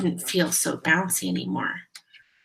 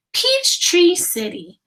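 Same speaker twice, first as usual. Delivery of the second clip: higher, slow sign reading; 9 semitones higher, 6 dB louder than usual. Acted. The highway sign greeting drivers, slow and higher. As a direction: read the road sign out grandly.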